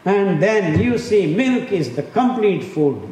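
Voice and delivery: high, slow, loud mock proclamation. Speaker mocking milk promoters to an audience, high-pitched proclamation.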